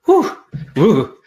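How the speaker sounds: sudden high, loud whoops; real delight bursting out; excited whoops. Real, not acted. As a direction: let out a joyful cheer. Cheering after the energy exercise.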